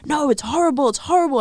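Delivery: high, loud whiny protest; mock complaining Dutch. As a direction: ham up the locals insisting it's horrible, high and loud. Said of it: "everyone wants to, like, be like".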